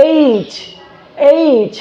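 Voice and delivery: "এইচ" ending on a distinct চ; slow careful "এইচ" with audible ch; clear final ch. Shown as a demonstration. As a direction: release the ch at the end of "এইচ". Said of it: "শেষে চয়ের উচ্চারণটা করতেছি".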